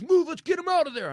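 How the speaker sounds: loud, high urgent yell; urgent shout. Retold or imitated. Bystander yells to pull the driver out, loud shout. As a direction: shout it like he's rushing to drag him out. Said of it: "he goes".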